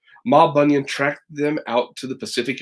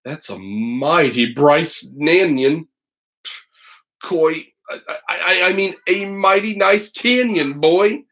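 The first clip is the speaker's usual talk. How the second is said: tongue-tied; 4 semitones higher than usual. A narrator's reading. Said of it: "Paul Bunyan got tongue-tied and said".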